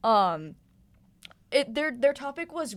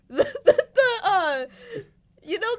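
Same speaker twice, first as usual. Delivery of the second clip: laughing through the words; giggling while talking; 10 semitones higher, 3 dB louder than usual. Real, not acted. Laughing mid-sentence.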